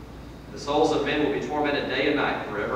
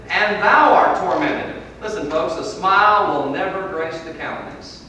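loud, raised scripture declamation; forceful preaching; 7 semitones higher, 9 dB louder than usual. Real, not acted. Preacher quoting Abraham's words to the rich man, forceful preaching. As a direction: proclaim the verse to the congregation.

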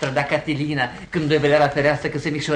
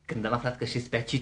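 normal talking voice

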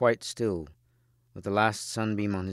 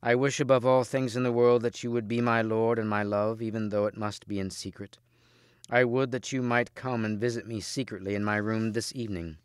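plain reading voice

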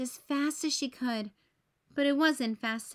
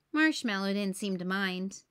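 plain reading voice

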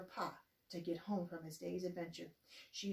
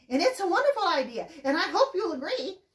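much higher, louder, fast and gushing; excited; 8 semitones higher, 12 dB louder than usual. Acted. Ma bursting to tell Pa her idea, highly excited. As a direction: spill it out breathless with joy. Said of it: "Pa couldn't believe her excitement".

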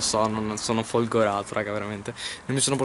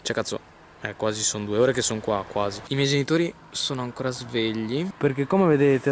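ordinary talk, nothing marked

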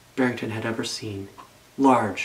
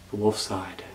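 ordinary delivery

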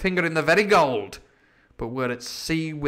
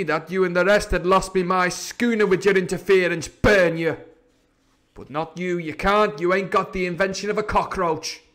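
raised, higher pirate voice, scolding hard; Silver, angry pirate leader; 6 semitones higher, 6 dB louder than usual. Acted. Silver tells off George over the lost schooner, angry. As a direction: berate a mutinous shipmate, loud and forceful.

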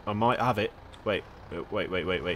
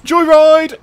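high, loud joyful shout; gleeful whoop; 11 semitones higher, 10 dB louder than usual. Real, not acted. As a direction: holler it out as you take off. Gleeful whoop starting a joyride.

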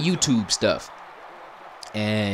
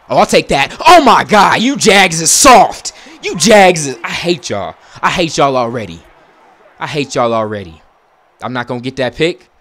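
loud, high exasperated rant at the players; frustrated yelling; 5 semitones higher, 12 dB louder than usual. Real, not acted. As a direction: shout at the useless team.

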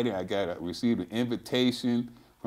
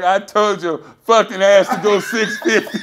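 mock scolding through laughter, louder; laughing I-told-you-so; 7 semitones higher, 10 dB louder than usual. Retelling his friend's scolding after the failed lift, laughing. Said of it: "He's like".

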